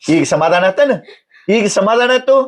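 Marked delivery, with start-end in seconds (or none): 1.6-2.3 higher-pitched, insistent repeat of the question; pressing reproach. Acted. Husband asks if satisfied after sister's tears, reproachful.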